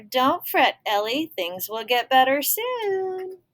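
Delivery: voicing a kind reassurance; bubble voiced. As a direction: cheer her up from the page.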